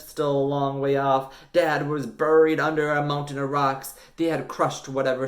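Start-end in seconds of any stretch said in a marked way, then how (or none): none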